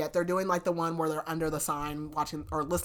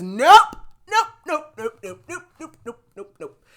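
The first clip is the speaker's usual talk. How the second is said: loud rising nopes that fade into rapid chatter; mock-outraged boyfriend refusing flatly; emphatic repeated refusal; 8 semitones higher, 13 dB louder than usual. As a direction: shut it down, nope after nope. Boyfriend reacts to study partner news.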